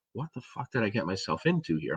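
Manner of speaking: very quiet retold inner voice; hushed dread. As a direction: say it under your breath, as a worried thought. Retold anxious thought while sneaking in.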